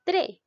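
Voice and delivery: "tre" pitched high and punched; single out "tre" as the strong syllable; a high, emphatic "tre" on its own; stressed syllable. Shown as a demonstration. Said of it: "la mayor fuerza de voz recae en la sílaba".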